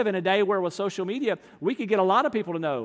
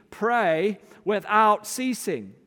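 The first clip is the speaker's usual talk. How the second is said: slow scripture reading; much slower than usual. Shown as a demonstration.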